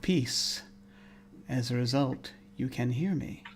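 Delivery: soft, measured, hushed phrases; whispering voice. Acted. Unseen presence addressing the one who can hear, hushed murmur. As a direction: murmur it softly, pausing between phrases. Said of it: "the voice whispers to you".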